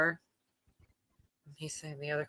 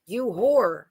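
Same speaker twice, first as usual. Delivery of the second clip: a hint of a furious man, slightly louder; mock Mel Gibson; 3 semitones higher, 6 dB louder than usual. Podcaster lightly mimics Mel Gibson's ranting insult. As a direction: lightly imitate a ranting caller. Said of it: "Mel Gibson saying".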